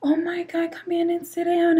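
high, alarmed caring voice; concerned lady. Retold or imitated. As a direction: gasp it like a worried clerk. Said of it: "she was just like".

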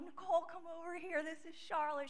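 high, excited call to Nicole; calling sister-in-law over. Retold or imitated. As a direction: wave her over, thrilled. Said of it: "I'm, like, crying".

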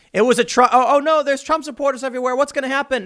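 high, hammed-up panic; mock panic. Host mocking the actress scared of Trump supporters, mock panic. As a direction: fake a frantic, high-pitched fright.

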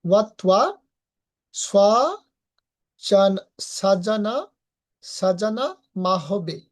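ওয়াত্বা স্বাজানা said slowly in pieces; slow syllable demo. Shown as a demonstration. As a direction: stretch সাজানা মা হবে out syllable by syllable. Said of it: "হয়ের উচ্চারণটা পুরোপুরি হবে".

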